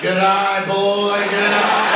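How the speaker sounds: put-on loud, sing-song praise for the kid; loud proud praise. Dad praising son's swearing, loud sing-song cheer. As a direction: cheer him on like a proud coach. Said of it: "I look back".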